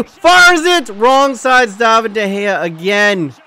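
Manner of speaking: loud, drawn-out penalty call; big, emphatic commentator delivery; excited play-by-play. Real, not acted. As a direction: open on a high shout, then stretch the words slowly.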